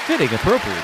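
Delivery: lightly echoing a solemn announcer; imitating the announcer. Host echoes the announcer's Kobe tribute call, a mild mock. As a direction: quote the broadcaster with a touch of gravity. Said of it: "the announcer said".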